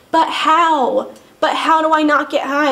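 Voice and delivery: desperate questioning. Retold or imitated. Arguing with detox staff.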